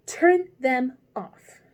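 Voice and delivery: loud, slow angry order; angry command. A narrator's reading. Queen angrily orders the gardeners turned over. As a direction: bark it out, turning away. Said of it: "turned angrily away".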